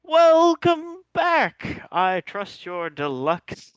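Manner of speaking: grand drawn-out welcome from the hall; calling out. Acted. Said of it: "from the hall call out".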